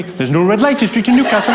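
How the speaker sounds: lilting Geordie cabbie; Geordie accent. Retold or imitated. Cab driver to passenger, apropos of nothing, Geordie. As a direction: swing up and down in broad Newcastle. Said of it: "tough, Geordie bloke".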